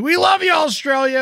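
high loud call to Australia; shouted love. Real, not acted. Cohost shouting love to Australian fans, loud and high. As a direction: call it out to the whole country.

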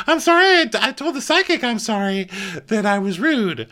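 high mock Brandy voice; Brandy impression. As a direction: squeak it out like a flustered Brandy.

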